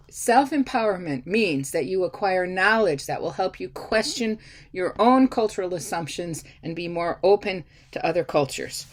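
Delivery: ordinary talk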